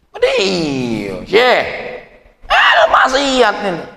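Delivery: teriakan tinggi, lambat dan keras; murid marah. Retold or imitated. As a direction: protes marah pada sang syekh. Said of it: "muridnya marah". (murid berteriak marah)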